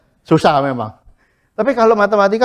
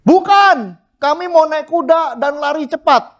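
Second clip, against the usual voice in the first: high defiant voice; pitched up, cocky refusal; stubborn people voiced; 9 semitones higher than usual. Preacher voices stubborn Israel refusing God, high and defiant. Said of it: "yang kuning".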